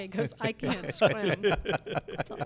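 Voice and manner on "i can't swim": loud retold plea, imitating a friend; Andy. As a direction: blurt it loudly as a quoted confession. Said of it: "I could just hear him in his voice saying that".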